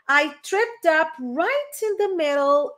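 slow, careful English model; slow model sentence. Shown as a demonstration. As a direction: spell it out slowly. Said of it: "Tropecei bem no meio da rua". (frase modelo lenta em inglês)

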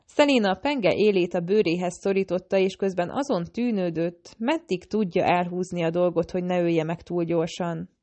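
ordinary delivery

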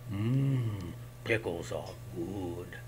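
low, soft, slow greedy goblin; fat goblin. Acted. Fat goblin enjoying the pickle, low and slow.

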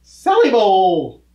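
loud, drawn-out Sally Bull; shouted punchline. Acted. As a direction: belt out the punchline slowly. Shouted punchline of knock-knock joke.

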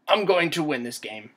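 loud, firm declaration; determined vow. Real, not acted. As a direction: declare victory out loud to your opponent. Vowing to beat the monster.

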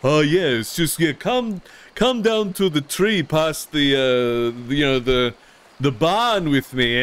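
a light, sly villain voice that lures someone in; coaxing, lightly put-on nobleman; Lord Lionheart voice. Streamer replaying his vampire lord luring a lawman, sly. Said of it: "so I was immediately like".

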